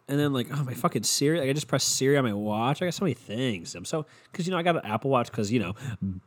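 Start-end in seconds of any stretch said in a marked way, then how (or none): none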